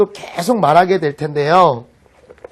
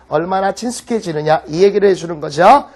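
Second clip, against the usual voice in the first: usual speaking voice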